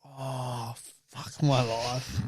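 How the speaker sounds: very soft, slow, low groan; weary despair. Retold or imitated. Embarrassed driver giving up, slow and very soft. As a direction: drag it out hopelessly.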